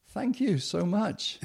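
slow, high-pitched gratitude; overwhelmed gratitude, acted out slowly; heartfelt thanks. Grateful reply to the helping passengers.

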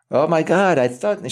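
high, lightly put-on Israeli accent; a hint of a bad Israeli accent, exclaiming. Actor imitating the script supervisor in a bad Israeli accent. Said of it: "I was my bad Israeli accent".

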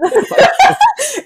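high laugh. Real, not acted.

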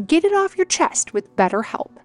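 ordinary delivery

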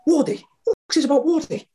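high, fast mock rant; hammed-up raging boss; angry manager. Manager raging at agent over phone, mock angry.

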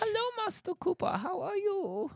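put-on high-pitched European accent, far above own voice; squeaky-high mock European greeting. Hailing Master Cooper on a Europe trip, put-on European accent. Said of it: "My accent is falling apart".

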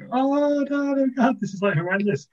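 very high mock child wail; little four-year-olds. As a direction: squeal like horrified little kids. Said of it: "little four-year-olds are like".

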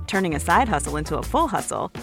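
ordinary talk, nothing marked

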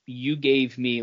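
ordinary talk

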